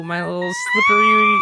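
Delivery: very high squealing shout. Acted. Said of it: "That was not a scream".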